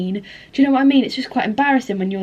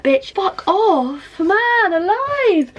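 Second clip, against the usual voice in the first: drawn-out high sneer, much higher and slow; dismissive retort; 9 semitones higher, much slower than usual. Retold or imitated. Telling off TikTok commenters, high slow scornful drawl. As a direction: stretch it out scornfully, pitched way up. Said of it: "And I'm like".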